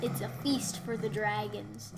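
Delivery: ordinary talk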